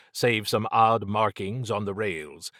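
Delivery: ordinary delivery, nothing marked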